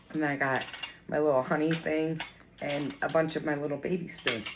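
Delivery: ordinary talk, nothing marked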